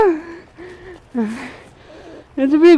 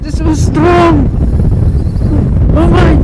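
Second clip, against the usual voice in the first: loud, high whoops of triumph; real cheers as the fish fights; excited cheering; 6 semitones higher, 4 dB louder than usual. Real, not acted. Angler landing a fish, whooping loud and high.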